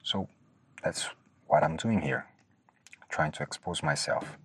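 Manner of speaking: normal talking voice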